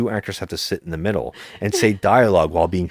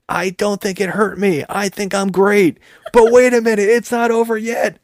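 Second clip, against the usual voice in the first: high, louder mock hero bravado; 13 semitones higher, 3 dB louder than usual. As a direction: play the hero's cheesy bravado. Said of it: "And he says".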